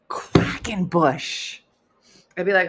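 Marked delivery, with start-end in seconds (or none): 0.1-1.6 slow, voiceless laughing wheeze; breathless with amusement. Real, not acted. Host marveling at a fan's maiden name, wheezing it out. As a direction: squeeze the word out slowly on a laughing whisper.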